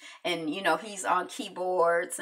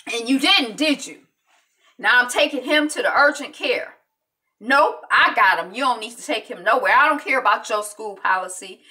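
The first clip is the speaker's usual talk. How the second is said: angry father; 4 semitones higher, 8 dB louder than usual. Retold or imitated. Angry father confronts coach.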